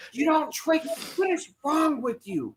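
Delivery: high, incredulous mock scolding; scolding mom. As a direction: imitate an appalled mother telling someone off. Voices mother scolding the prankster.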